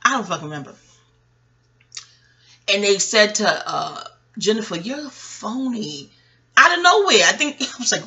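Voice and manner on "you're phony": slow, soft, sweetly polite insult; nice and polite. Retold or imitated. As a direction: say it kindly, almost gently. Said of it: "in such a nice, polite way".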